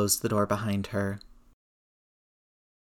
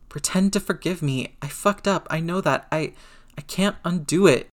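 high, anguished confession; voice cracking; 7 semitones higher than usual. A narrator's reading. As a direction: spill out guilt in a breaking, high voice. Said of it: "Peter's stupid-ass voice cracked".

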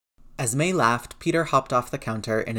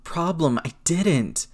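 raised, halting, choked distress; croaked; 6 semitones higher, slower than usual. A narrator's reading. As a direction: force it out haltingly, pitched high. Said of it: "Peter croaked".

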